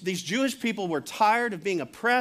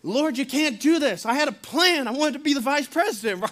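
louder, higher pleading outburst; upset protest; 6 semitones higher, 4 dB louder than usual. Retold or imitated. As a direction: cry out like a disciple whose plans just collapsed. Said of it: "So Peter's upset".

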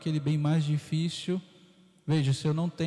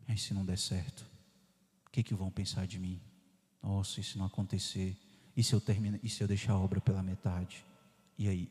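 fearful worry; 6 semitones lower than usual. Retold or imitated. Priest voicing fear of failure, mildly anxious.

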